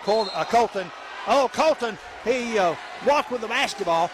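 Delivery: high, shouted call; excited shout. Real, not acted. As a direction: catch the travel with a surprised shout. Traveling call in the action.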